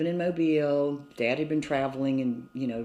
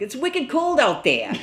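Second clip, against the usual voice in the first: imitating a wicked Boston accent, higher and louder; mock Boston local, bright and loud; 12 semitones higher, 7 dB louder than usual. Kid recalling Boston neighbors in a Boston accent. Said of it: "I just loved the accents".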